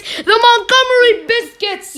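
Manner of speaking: high, loud team announcement; big announcer call. Pretend. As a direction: belt out the name like a hype man.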